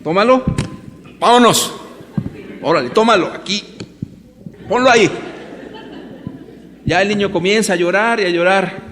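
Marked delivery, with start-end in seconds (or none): 0.0-5.1 forceful father. Pretend. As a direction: order the kid around roughly, half-laughing. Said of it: "Lo agarro de la oreja".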